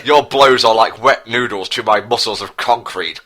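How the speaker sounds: normal talking voice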